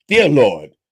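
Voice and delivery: mock sermon prayer; preacher prayer. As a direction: intone it like a preacher. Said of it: "prayer again".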